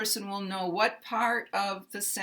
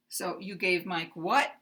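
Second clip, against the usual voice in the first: you gave Mike what rising on what; uptalk; 3 semitones lower than usual. Shown as a demonstration. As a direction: pitch up on what at the end. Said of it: "move it to the end".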